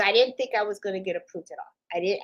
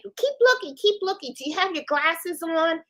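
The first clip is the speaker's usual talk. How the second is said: much higher, sing-song urging; mock helpful teasing; 7 semitones higher than usual. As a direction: egg her on innocently. Teasing the coworker during prank.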